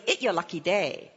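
low pidgin English voice. Retold or imitated. Masseur greeting a stranger in the park, pidgin English. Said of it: "speaks to me in pidgin English".

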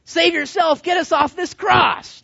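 loud, high jeering shout; mocking taunt. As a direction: heckle him at full voice. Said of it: "Now he's making fun of Jesus".

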